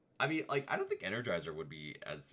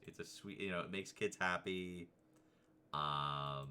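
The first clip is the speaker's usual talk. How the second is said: usual speaking voice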